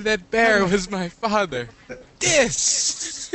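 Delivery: high, loud and slow; hammed-up tragic reveal; dramatic mock line.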